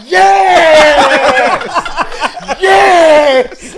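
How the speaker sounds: high, loud cheering shout; triumphant yell. Retold or imitated. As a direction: yell like you just scored. Dad acting goal celebration.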